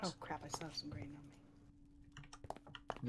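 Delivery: alarmed whisper aside. Real, not acted. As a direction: whisper it so the mic barely catches it. Sudden hushed aside mid-stream.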